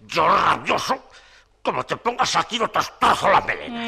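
furious loud threat; angry. Acted. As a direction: explode with rage. Insulted, threatening.

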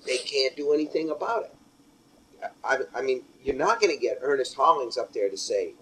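ordinary talk, nothing marked

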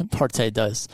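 put-on American-accented "Parte dos" with a full English r; Anglicized Spanish in an American accent. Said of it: "Ex-, American".

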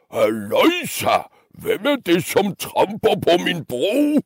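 mock roar swooping high; roaring troll. Troll challenging the little goat, high swooping roar. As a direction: bellow as a troll without much extra volume. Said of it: "brølede trolden".

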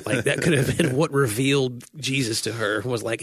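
ordinary delivery

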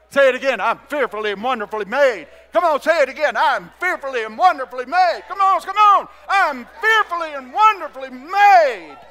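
loud, high, rousing call to repeat; full-force preaching. Real, not acted. Preacher urges congregation to say it again, building to a shout. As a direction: fire up the crowd with a shouted declaration.

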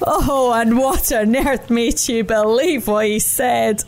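slow, lightly voiced chuckling bear; amused bear. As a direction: ask it with amused disbelief. Brown Bear after laughing.

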